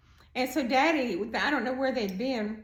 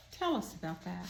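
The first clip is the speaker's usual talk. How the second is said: very gently; 4 semitones lower, 7 dB quieter than usual. Retold or imitated. Old inmate to the boy at the table, softer and gentle. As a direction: ease the boy into talking, very gently. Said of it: "very gently".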